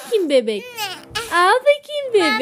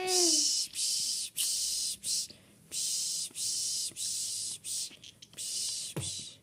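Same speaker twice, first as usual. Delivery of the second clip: whispered repeated hushing sounds; long soothing shushes to lull a baby; soft rhythmic shushing; 18 dB quieter, much slower than usual. Pretend. Lulling woken baby back to sleep, soft shushing.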